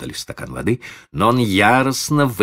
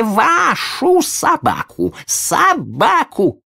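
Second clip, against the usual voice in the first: high, slow howl growing louder; wailing boy; 6 dB louder, much slower than usual. Acted. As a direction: wail it louder each time. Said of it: "Залился громче прежнего мальчик".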